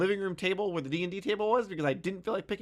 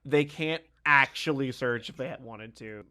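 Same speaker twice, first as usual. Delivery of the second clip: ordinary talk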